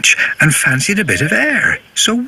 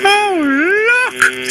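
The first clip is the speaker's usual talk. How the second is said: delighted Mavis. Acted. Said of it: "said Mavis".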